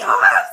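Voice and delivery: high, loud squeal of joy; fangirl scream. Retold or imitated. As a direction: shriek of delight. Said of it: "and I was like".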